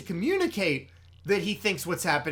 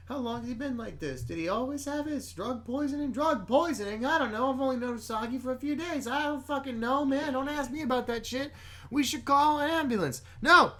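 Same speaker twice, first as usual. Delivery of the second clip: high, agitated argument in a crisis; acted panic; 8 semitones higher, 5 dB louder than usual. Podcaster acting out characters arguing over an ambulance, panicked. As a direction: play flustered friends getting more frantic, pitch climbing.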